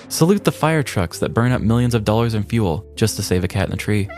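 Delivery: ordinary talk, nothing marked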